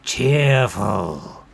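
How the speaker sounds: loud, stretched-out echo of the request; a hint of a disdainful bard; reluctant goblin bard. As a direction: repeat it as if it were distasteful.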